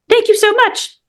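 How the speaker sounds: squeaky high grateful alien. Acted. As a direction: thank them in a tiny high voice.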